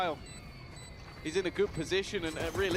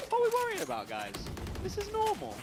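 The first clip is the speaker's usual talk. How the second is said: mock reassurance. Mock calm while the team struggles.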